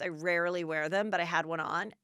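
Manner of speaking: usual speaking voice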